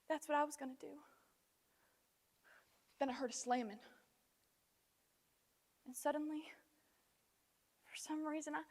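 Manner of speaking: soft, halting, long pauses; quiet and hesitant, drained of force; subdued aftermath. Acted. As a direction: drop to a hushed confession. Subdued aftermath of shouted confession.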